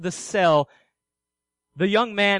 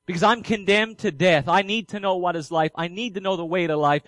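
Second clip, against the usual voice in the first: ordinary talk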